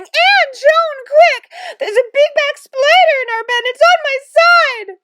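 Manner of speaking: very high, loud panicked squeal. A narrator's reading. Mother bursts in to warn the twins of a spider, squealing. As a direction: squeal the alarm at full voice, drawing out the end. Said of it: "clutching her bathrobe and squealing".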